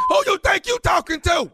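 high, indignant challenge; confrontational shout. Acted. Jailed caller challenges the husband, shouting. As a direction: shout him down. Prankster acts angry jailed boyfriend.

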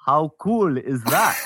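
slow, laughing impression of a buddy; drawn-out, amused friend voice; Ben impression. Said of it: "here's a Ben".

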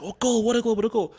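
loud, high goal call; imitating commentators celebrating a goal; excited commentator. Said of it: "most guys be like".

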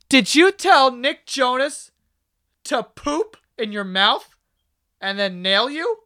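high, slow, mock-shocked parent questioning; mock parent confronting. Parents storming in on their troll kid, appalled and slow.

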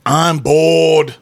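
mock Australian drawn-out catchphrase; imitated Australian YouTuber. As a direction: put on a broad Australian accent. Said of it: "he's Australian, I think".

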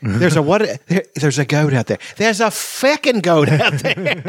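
put-on Scottish accent, high and childlike; Scottish child voice. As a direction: mimic a wee Scottish child. Continues Scottish child quote.

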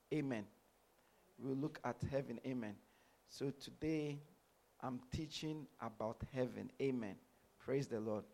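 ordinary talk, nothing marked

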